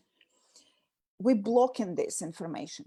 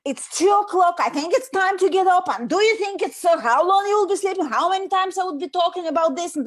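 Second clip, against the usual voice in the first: higher and louder, fast nagging at a sleepy son; nagging mother; 6 semitones higher, 7 dB louder, faster than usual. Shown as a demonstration. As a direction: scold him awake, rushing the words. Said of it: "And how I'm talking".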